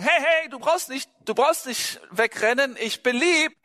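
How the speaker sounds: high-pitched, harmless-sounding mock plea; mock reassuring call. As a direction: act out calling after someone running off. Imagined calling after frightened, fleeing girl.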